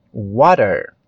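"water" with a tapped T, near D or R; American pronunciation. Shown as a demonstration. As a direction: flap the T in "water". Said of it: "ele já pronuncia mais water".

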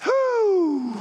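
high exhaled whew, out of breath from running; breathless whew. Retold or imitated. Airman arriving at his boss winded from running, high whew. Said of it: "I was like".

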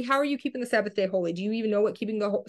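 ordinary talk, nothing marked

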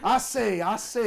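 loud, high Southern cartoon bluster. Joking to friends about Southern Shakespeare, blustery Southern accent. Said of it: "not like Foghorn Leghorn".

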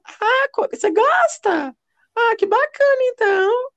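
very high, drawn-out falsetto imitation of the ex; hammed-up catlike falsetto caricature; effeminate mock voice. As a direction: mimic him way up high, stretching the words. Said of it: "Ela imitando o jeito dele".

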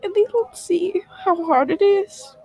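real crying, pitched high; words pushed out through tears. Real, not acted. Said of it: "Oh, I'm kinda crying".

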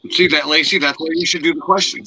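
loud and excited. Real, not acted. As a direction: call it out, pleased. Excited at a good question.

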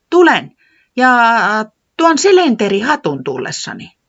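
high, loud little voice; Tiputi hinting. Acted. Tiputi giving a final hint, high and loud. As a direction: say the hint boldly.

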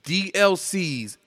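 usual speaking voice

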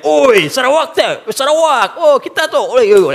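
mock excited shout of recognition; excited greeting. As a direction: hail a fellow Sarawakian across the room. Greeting a fellow East Malaysian.

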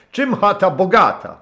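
put-on high, loud hearty old sailor's voice; a rough old skipper warming to his guest; gruff old captain. (serdeczny, szorstki głos starego kapitana)

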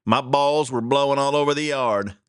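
ordinary talk, nothing marked

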